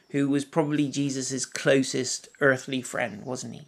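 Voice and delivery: ordinary talk, nothing marked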